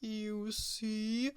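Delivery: soft, mopey groan; groaning Walrus. Acted. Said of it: "groaned Walrus".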